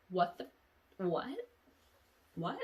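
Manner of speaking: normal talking voice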